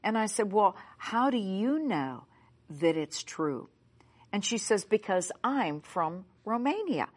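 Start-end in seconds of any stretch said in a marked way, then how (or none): none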